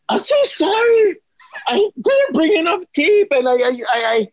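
a nervous rookie spilling everything at once; panicked high shout. Retold or imitated. Student trainer blurting an apology to her boss, panicked high shout.

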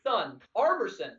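Armerson with both R's sounded; Clyde's mispronunciation. Retold or imitated. Demonstrating the broadcaster's two R's to co-hosts, Armerson. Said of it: "It was Armerson. Two R's".

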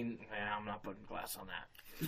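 low, soft resigned refusal; resigned mutter. Retold or imitated. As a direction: mutter it in defeat. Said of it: "has literally made me".

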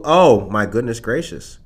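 ordinary talk, nothing marked

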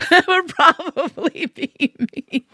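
high, laughing admission; sheepish words breaking into giggles. Retold or imitated. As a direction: laugh helplessly through a slow confession. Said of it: "I kinda laughed".

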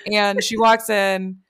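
ordinary talk

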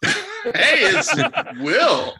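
laughing through the greeting. Real, not acted. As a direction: greet while cracking up. Laughing intro.

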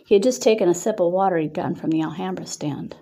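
ordinary delivery, nothing marked

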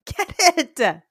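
put-on shrieking hype-girl cheer; hyped cheering. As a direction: egg her on like a cheering bestie. Cheering on a friend.

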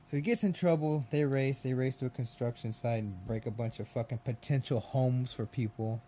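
usual speaking voice

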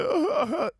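high mock crying sputters; mock sobbing. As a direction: imitate a friend's sobbing, high and silly. Imitating friend's crying.

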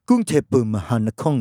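mock Japanese accent. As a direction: say it like a Japanese tourist. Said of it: "สำเนียงญี่ปุ่นอะ".

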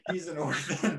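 cracking up mid-line; laughing. Real, not acted. Actor repeating a cohost's joke line, laughing. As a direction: say it through real laughter.

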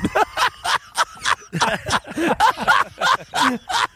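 squealing, tearful laughter; helpless laughter; high crying laugh. Real, not acted. Breaks down laughing.

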